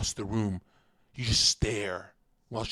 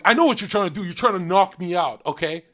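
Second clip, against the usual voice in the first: higher, louder mock tough-guy taunt; mock bar-fight bravado; 6 semitones higher, 9 dB louder than usual. Streamer imitates himself taunting a guy at a bar, loud mock bravado. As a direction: ham up a cocky drunk at a bar. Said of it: "trying to fight someone and going".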